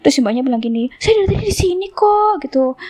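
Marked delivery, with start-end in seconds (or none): 1.0-2.5 high-pitched imitation of an indignant driver; retold indignant insistence. Driver claims she was waiting all along, imitated indignant insistence. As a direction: insist in a much higher mock voice.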